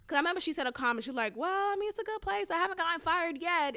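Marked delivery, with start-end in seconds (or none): 1.4-3.8 high-pitched mock coworker voice; lightly mocking, raised pitch; imitating a coworker. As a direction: quote her up high, falling off at the end. Quotes coworker's cringey remark.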